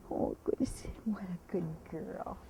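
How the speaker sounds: slow hushed whisper of praise. Real, not acted. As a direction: soothe her under your breath.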